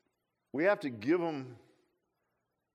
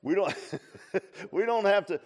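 laughing; 5 semitones higher, 6 dB louder than usual. Real, not acted.

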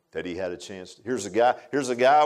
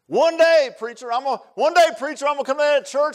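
loud, high mock voice of a procrastinator; loud imitation of a man telling the preacher he'll come someday; mocking promise; 13 semitones higher, 7 dB louder than usual. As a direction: ham up the boastful excuse.

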